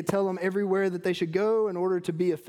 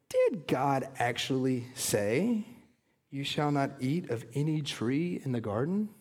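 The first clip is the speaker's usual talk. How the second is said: sarcastic serpent; 5 semitones lower than usual. Acted. Serpent taunting the woman, lightly voiced low sarcasm. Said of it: "the sarcasm in the voice".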